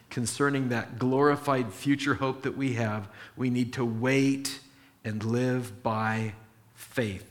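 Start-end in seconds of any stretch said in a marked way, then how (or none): 3.4-7.3 slow emphatic close. Shown as a demonstration. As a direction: lay down each word of the key verse. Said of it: "That's the emphasis".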